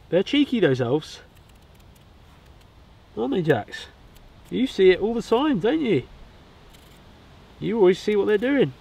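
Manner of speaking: high sing-song voice for the dog; talking to the dog. Real, not acted.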